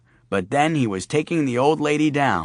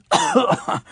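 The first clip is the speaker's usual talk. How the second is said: high hammed-up cough; theatrical death cough; dying cowboy cough. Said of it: "coughed the way cowboys do when they're dying".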